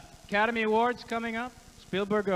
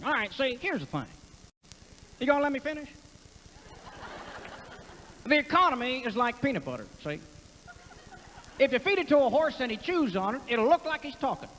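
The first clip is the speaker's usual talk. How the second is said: higher, folksy Texas twang; Ross Perot; 7 semitones higher than usual. Acted. Comic doing Perot's pointless analogy, high twang. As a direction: pitch it up like Perot's nonsense analogies. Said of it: "I loved his analogies that meant nothing".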